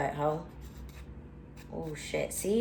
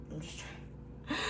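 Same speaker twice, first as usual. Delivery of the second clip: ordinary delivery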